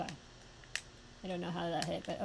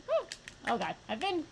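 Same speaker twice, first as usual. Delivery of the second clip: ordinary talk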